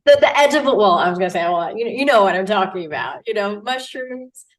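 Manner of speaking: ordinary delivery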